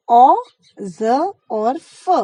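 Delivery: very slow "ज" as z and "फ" as f; nukta z and f. Shown as a demonstration. Teacher repeats the sounds for students, very slow. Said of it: "फिर से एक बारी बोल देते हैं".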